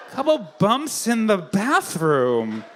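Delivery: slow, drawn-out swooping repeat; singsong, exaggerated wondering; mock puzzled echo. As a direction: stretch each word as if baffled. Echoes phrase as puzzled audience would.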